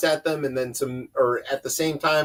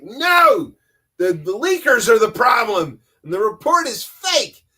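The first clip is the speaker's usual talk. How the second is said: loud, higher, indignant denial; mock defensive shout; 4 semitones higher, 4 dB louder than usual. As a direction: bark back that the leakers are the problem. Trump officials denying the leaked report.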